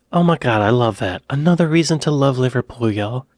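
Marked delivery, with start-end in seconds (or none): none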